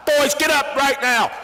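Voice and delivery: high, loud, urgent order; urgent command. Retold or imitated. Father ordering his sons out of bed, loud and high. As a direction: rouse them right now.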